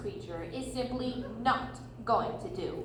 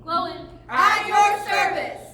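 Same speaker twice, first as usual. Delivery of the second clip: loud, lifted name roll call; 3 semitones higher, 8 dB louder than usual. Acted. Dwarves present themselves to hobbit, loud. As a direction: call each name out proudly. Dwarf announces himself.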